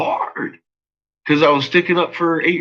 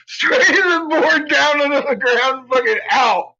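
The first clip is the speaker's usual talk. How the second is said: high-pitched, cracking up mid-story; laughing while telling; 12 semitones higher than usual. Real, not acted. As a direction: barely get the story out through laughter. Telling a funny mishap, cracking up.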